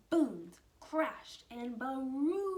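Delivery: loud, drawn-out thunder words; slow, loud booming crescendo. A narrator's reading. Thunder arriving in story.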